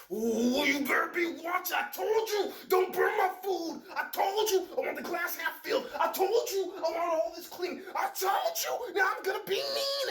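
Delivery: a domestic tyrant barking orders; bossy tyrant voice. Acted. Abuser scolding partner at home, screeching. Voicing a harsh partner privately.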